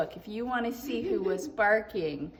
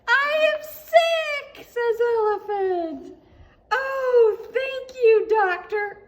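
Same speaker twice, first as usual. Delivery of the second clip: joyful shout; 11 semitones higher, 12 dB louder than usual. Acted. Gerald overjoyed at cold.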